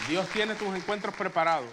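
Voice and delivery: full-voice emphatic preaching, stress on tiene; the first time is shouted out; loud preached declaration. Shown as a demonstration. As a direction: proclaim it loud to the whole room. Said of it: "repeated line, preached loud".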